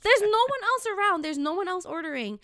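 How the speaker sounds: high-pitched, incredulous complaint; exasperated protest. Retold or imitated. Customer alone in the deli scolding the sandwich guy, high exasperation.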